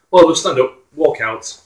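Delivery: ordinary delivery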